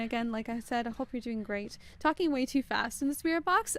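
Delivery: ordinary talk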